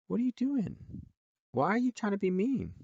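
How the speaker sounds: high, scolding pet-talk voice; high sing-song scolding of a pet; talking to the bird. Real, not acted.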